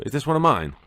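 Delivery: high, loud excited question; excited self-quote. Retold or imitated. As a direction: blurt it out, hopeful. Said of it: "I'll go".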